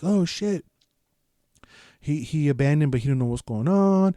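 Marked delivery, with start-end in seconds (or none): 0.0-0.6 high-pitched imitation of a little boy; lightly voiced flamboyant kid. Little Bubbles reacts, imitated as a small boy. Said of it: "little Bubbles is all like".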